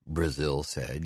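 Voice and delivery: ordinary delivery, nothing marked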